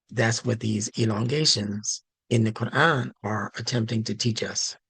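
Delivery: ordinary delivery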